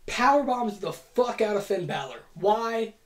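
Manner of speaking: ordinary talk, nothing marked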